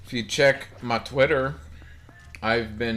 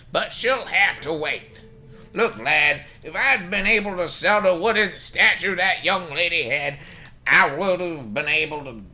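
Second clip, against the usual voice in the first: put-on high, loud, put-upon old doctor grumbling; high-pitched cranky old man complaining about his debts; crusty doctor voice; 8 semitones higher, 4 dB louder than usual. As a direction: play him as a stingy old codger brushing off a visitor.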